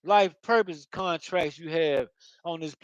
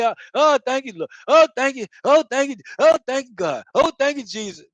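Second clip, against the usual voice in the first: higher, louder gushing oh thank yous; mock begging thanks; 4 semitones higher, 3 dB louder than usual. Mocking a worshipper thanking God too much, begging. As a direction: pile on the thanks, overdone. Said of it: "God don't want you begging him".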